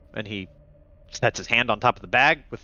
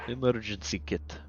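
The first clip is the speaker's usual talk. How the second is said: low, quiet doctor voice, halting between phrases; weary underground doctor; 4 semitones lower, 5 dB quieter than usual. Acted. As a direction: speak low and deliberately, resigned.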